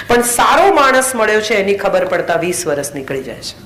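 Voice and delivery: usual speaking voice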